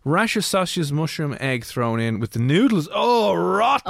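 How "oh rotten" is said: higher, recoiling groan of revulsion; disgusted outburst, pitch jumping up; real disgust. Real, not acted. Reacting to a breakfast stir fry with noodles, openly disgusted.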